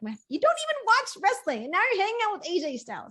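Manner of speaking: high, fast jealous whine; mock jealous outburst. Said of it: "I was like".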